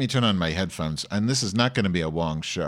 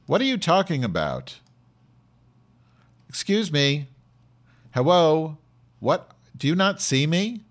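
high-pitched, exasperated demands to be noticed; indignant calling out; 7 semitones higher, 3 dB louder than usual. Acted. Host challenging listeners who ask where [name] is, indignant. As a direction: call out incredulously, voice pitched well up.

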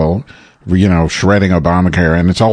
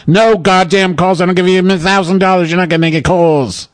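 higher, furious ranting at an assistant; boss yelling; 8 semitones higher than usual. Acted. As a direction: chew out the staffer like an enraged boss.

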